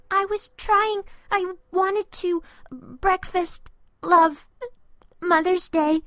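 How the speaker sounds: high, broken phrases close to crying; a boy struggling to explain through tears; tearful stammer. A narrator's reading.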